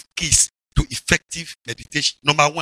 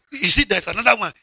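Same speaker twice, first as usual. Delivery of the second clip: ordinary talk, nothing marked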